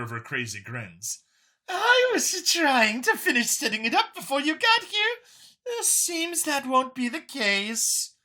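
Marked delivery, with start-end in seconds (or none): 1.7-8.1 much higher, bubbly alien scientist; excitable Xeno. Acted. Xeno caught setting up the suit, high and eager. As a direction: play the eager grinning Xeno.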